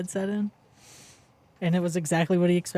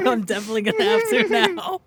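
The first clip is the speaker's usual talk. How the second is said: words squeezed out through laughter, high; laughing hard while talking; 9 dB louder than usual. Real, not acted.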